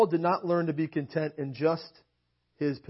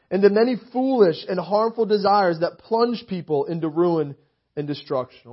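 higher, louder scripture reading, preaching it; 7 semitones higher, 10 dB louder than usual. Acted. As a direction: proclaim the verse to the room.

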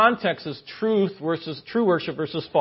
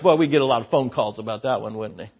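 usual speaking voice

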